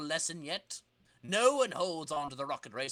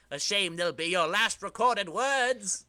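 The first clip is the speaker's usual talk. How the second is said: slow, louder gloat; gloating villain; 6 semitones higher, 4 dB louder, slower than usual. Acted. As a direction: savor the threat, slowly.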